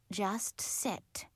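soft, quiet interruption; hushed firm order. A narrator's reading. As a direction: cut the protest off softly. Leader cuts off protest, hushed.

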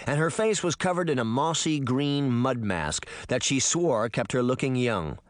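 ordinary delivery, nothing marked

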